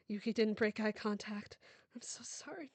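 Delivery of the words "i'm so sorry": a breathy, higher whisper; whispered apology. Acted. Mother whispering sorry to her dying daughter.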